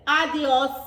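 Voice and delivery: loud adios; loud goodbye. Acted. Friends leave the goat, loud adios. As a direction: wave him off loudly.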